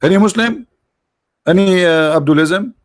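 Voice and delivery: calling out. Real, not acted. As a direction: call out for any challenger in the audience. Calling out to the audience.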